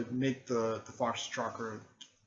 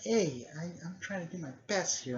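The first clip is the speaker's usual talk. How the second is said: put-on high-pitched defensive girl; imitated protest; 8 semitones higher than usual. Girl protests being belittled.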